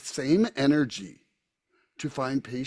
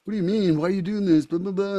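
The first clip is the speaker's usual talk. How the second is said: higher, louder mock of a nagging friend; 7 semitones higher, 6 dB louder, much faster than usual. Imitating a friend who teases him about early bedtimes, higher and louder. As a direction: play the pestering buddy, half-mocking. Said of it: "I've got a friend who's like".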